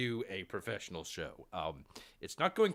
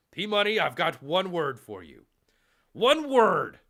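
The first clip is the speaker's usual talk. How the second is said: teasing shout; 8 semitones higher, 11 dB louder than usual. Acted. Taunting a rival hockey fan.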